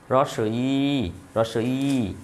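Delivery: long, slow ই in রস ই; drawn-out vowel. Shown as a demonstration. Teacher drills class on the vowel, drawn-out ই. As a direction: stretch the ই well out. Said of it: "একটু টান হবে".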